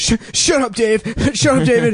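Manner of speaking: put-on high-pitched, stammered kid telling off a friend; flustered twelve-year-old, mock irritation; annoyed kid. Acted kid snapping at his friend.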